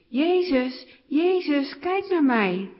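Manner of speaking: high, loud plea for attention; calling out loudly. A narrator's reading. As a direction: cry out over a crowd, pleading. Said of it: "Hij begint hard te roepen".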